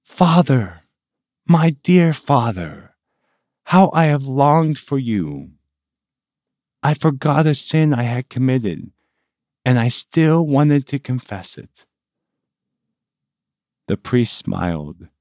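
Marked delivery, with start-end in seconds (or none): 0.0-11.9 joyful, raised and brighter voice. A narrator's reading. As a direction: greet him with sudden joy. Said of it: "and with joy said".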